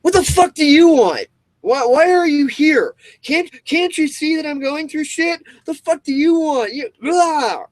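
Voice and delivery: high, loud furious outburst; acted stressed-out yelling at a friend; angry snapping. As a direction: snap at the visitor like they're an intrusion. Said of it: "instantly react with".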